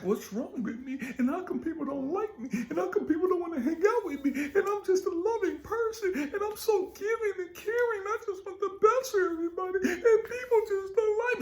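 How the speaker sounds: high mocking whine, rising toward a fake sob; hammed-up wounded complainer; whiny self-pitying victim. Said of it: "we've been walking around like".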